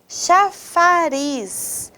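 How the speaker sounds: CHAFARIZ drawn out slowly, long RIZ; stressed final syllable. Shown as a demonstration. Teacher quizzing students on stress, CHAFARIZ very slowly with long RIZ. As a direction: stretch CHAFARIZ syllable by syllable towards a held RIZ. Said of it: "Qual é a sílaba mais forte".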